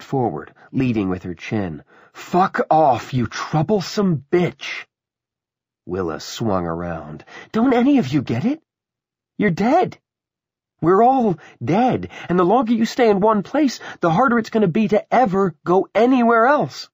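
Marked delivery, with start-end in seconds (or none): 2.1-4.9 high, loud curse slowing at the end; angry insult. Acted. Ursula stepping up to Willa, angrily cursing. 7.5-16.9 high, loud, urgent insistence; exasperated woman. Acted. Willa telling the passengers they are dead, exasperated.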